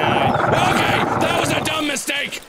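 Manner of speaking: loud, high alarm; panicked yell. Real, not acted. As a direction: yell it while fleeing a game enemy. Panicking at game enemy.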